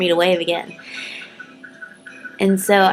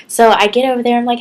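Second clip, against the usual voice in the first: ordinary delivery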